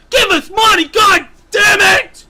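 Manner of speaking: high, very loud frantic screaming; screaming plea. Acted. The paywall message begging fans for money, screamed. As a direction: scream it like an exasperated demand.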